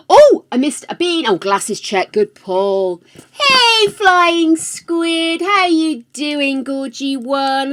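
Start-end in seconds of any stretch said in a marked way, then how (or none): 3.3-7.7 high, loud and drawn-out hello; effusive greeting. Real, not acted. Streamer welcoming a viewer who just arrived, effusive. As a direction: greet them in a big singsong call.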